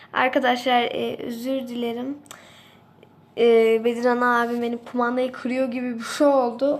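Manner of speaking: ordinary delivery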